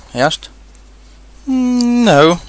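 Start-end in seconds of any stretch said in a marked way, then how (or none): 1.5-2.4 high, drawn-out hum before the answer; careless. A narrator's reading. Pooh denying to Rabbit he is stuck, careless. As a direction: play it off as nothing. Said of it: "said Pooh carelessly".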